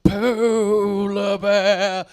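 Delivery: very slow, eerie drawn-out "Polar bear"; ghostly Attenborough. Retold or imitated. Comic mocking Attenborough's spooky "Polar bear", very slow. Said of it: "Why does he say it like the ghost of Christmas future".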